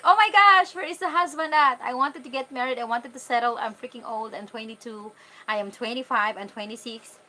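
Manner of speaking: mock panic. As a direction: exclaim like fretting over a missing husband. Acts out her panic at twenty-two.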